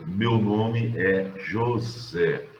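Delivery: meu nome é José dragged out slowly; drawn-out teaching example. Shown as a demonstration. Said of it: "É a tal coisa".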